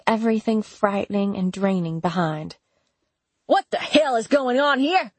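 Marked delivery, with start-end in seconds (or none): 3.5-5.1 bursting in furious on the couple; yelled. Acted. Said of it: "Duke yelled".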